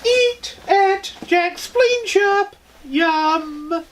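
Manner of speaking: very high, very slow, drawn-out fake commercial; sing-song cheesy ad read, stretched out; mock ad jingle voice. Podcast host fakes an ad at the break, high slow ad voice.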